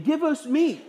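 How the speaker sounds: high-pitched imitation of a wailing demand; mock weeping plea. Moses quotes the weeping people's demand, mock wailing. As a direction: plead like a complaining crowd, pitched well up. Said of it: "For they're weeping".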